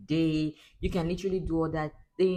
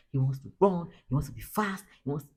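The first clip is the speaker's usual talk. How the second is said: ordinary talk, nothing marked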